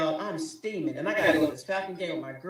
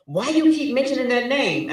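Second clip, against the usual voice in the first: higher, louder tough-guy husband; husband acting tough; 8 semitones higher, 8 dB louder than usual. Husband walking in, playing tough with his wife. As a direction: puff up like Billy Bad. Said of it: "like, all Billy Bad".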